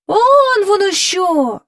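high, long drawn-out exclamation of realisation; crying out. A narrator's reading. Courtiers suddenly noticing the star, crying out. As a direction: stretch it out in wonder. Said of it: "закричали придворні".

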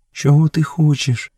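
high, hushed woman's voice; lightly suggested stage whisper; whispered question. A narrator's reading. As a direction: ask timidly, pitched well up. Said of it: "прошепотіла Месуа".

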